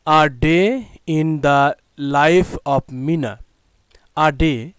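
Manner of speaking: slow, careful read of the unit title; teacher reading a title word by word. Shown as a demonstration. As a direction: spell out the title slowly for the class. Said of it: "class সিক্সের ইংলিশের unit seven".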